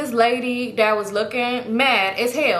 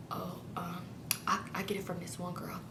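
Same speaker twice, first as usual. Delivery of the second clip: very soft, low, hesitant murmur; barely audible acted reply; retold hushed reply; 5 semitones lower, 19 dB quieter than usual. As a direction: answer under your breath, as if in church. Answering seatmate during the funeral.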